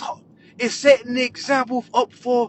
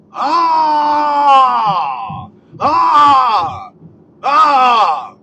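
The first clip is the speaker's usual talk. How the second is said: loud, drawn-out high "ah"; hammed-up crying out, falling away; mock wail; 3 semitones higher, 7 dB louder, much slower than usual. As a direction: wail three times in exaggerated outrage. Mimics outcry over the vote.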